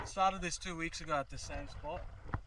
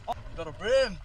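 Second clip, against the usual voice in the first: excited; 4 semitones higher, 8 dB louder than usual. Real, not acted. Excited at landing a fish.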